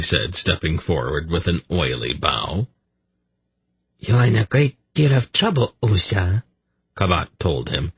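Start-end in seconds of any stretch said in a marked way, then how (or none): 4.0-6.4 higher-pitched, throaty near-purr; almost purring. A narrator's reading. Priestess telling Usa he is in trouble, almost purring. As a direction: purr it at the accused. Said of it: "her throaty voice almost purring".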